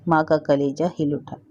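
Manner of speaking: ordinary delivery, nothing marked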